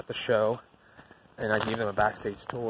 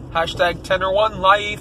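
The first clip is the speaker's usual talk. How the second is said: hyped catchphrase; 9 semitones higher, 9 dB louder than usual. Shown as a demonstration. Vlogger's catchphrase, punched up.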